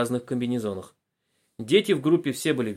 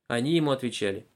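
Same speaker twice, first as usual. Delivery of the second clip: ordinary delivery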